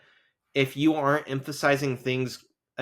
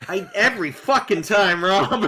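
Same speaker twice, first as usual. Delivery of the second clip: loud, high, playful outburst; mock exasperation; 6 semitones higher, 6 dB louder than usual. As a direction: blow up at your co-host in mock outrage.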